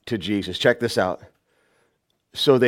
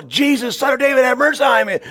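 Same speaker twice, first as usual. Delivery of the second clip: fast, high shouted plea; hurried high-pitched cry for help; 12 semitones higher, 3 dB louder than usual. Acted. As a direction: shout urgently for mercy. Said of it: "he could cry out louder".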